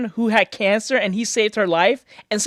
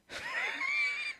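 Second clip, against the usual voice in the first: high, soft wheezing laugh; real high-pitched giggle fit; 19 dB quieter than usual. Real, not acted. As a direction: crack up in a thin, high wheeze. Cracks up at the story.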